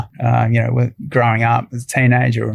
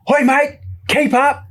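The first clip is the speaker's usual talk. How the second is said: much higher Steve Irwin holler; imitating Steve's call; slower than usual. Retelling Steve calling him over, much higher call. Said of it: "he goes".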